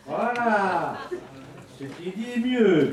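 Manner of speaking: high, loud, laughing; laughing triumphantly. Real, not acted. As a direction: laugh it out, pleased. Laughs at the thanks received. (voix haute et rieuse)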